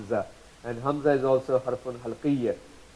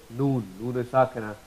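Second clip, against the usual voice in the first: ordinary talk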